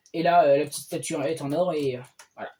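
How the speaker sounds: normal talking voice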